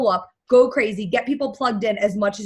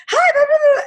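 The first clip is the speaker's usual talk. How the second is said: much higher, louder squealed greeting; gushing hello; 8 dB louder than usual. Retold or imitated. Christine greeting the shy newcomer at the party, gushing. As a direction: gush like a thrilled friend. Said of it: "she's like".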